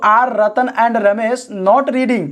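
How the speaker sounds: very slow, word by word; dictating slowly to the class; slow dictation. Shown as a demonstration. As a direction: spell it out slowly for students. Dictating the English translation.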